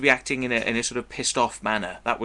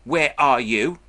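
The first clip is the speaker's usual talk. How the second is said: imitating caller; 4 semitones higher, 7 dB louder, slower than usual. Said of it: "it would be like".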